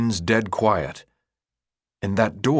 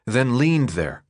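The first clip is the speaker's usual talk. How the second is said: plain reading voice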